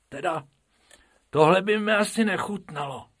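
high little rabbit voice; squeaky rabbit, lightly played; Bobek's voice. A narrator's reading. (vysoký králičí hlásek)

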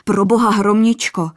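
frantic cry to his bride; blurted in terror. A narrator's reading. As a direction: blurt it out in fright. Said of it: "vyhrkl zděšeně".

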